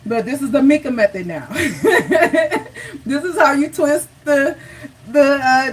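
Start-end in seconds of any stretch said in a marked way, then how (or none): 1.5-3.0 bright burst of real laughter. Real, not acted. Host laughing about naming the Mika method. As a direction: laugh at your own joke, higher.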